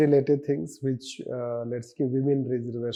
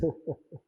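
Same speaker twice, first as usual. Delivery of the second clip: brief, quiet laugh; soft chuckle; 4 semitones higher, 5 dB quieter than usual. Real, not acted. Teacher laughing at own lack of preparation, soft chuckle. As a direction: chuckle softly to yourself. Chuckles after admitting not reading.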